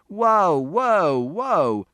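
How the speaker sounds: loud, drawn calls stepping down in pitch; shouted intervention. A narrator's reading. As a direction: shout to halt the fight. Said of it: "Mister Shears shouted".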